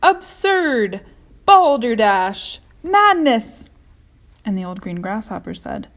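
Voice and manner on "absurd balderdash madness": loud, high shouted protests; shouting. A narrator's reading. The bugs keep shouting down James's plan, very loud. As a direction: hurl each word like an insult. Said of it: "they shouted".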